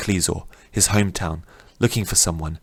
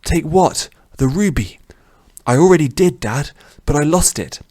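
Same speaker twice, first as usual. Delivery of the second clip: frantic; 8 semitones higher, 4 dB louder than usual. Acted. Frantic son by dying father.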